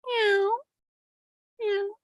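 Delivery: high mock meow; cat meow. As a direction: play the cat's meow. Voicing the cat.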